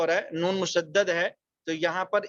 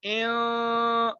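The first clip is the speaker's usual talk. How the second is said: normal talking voice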